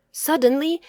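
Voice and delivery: ordinary delivery, nothing marked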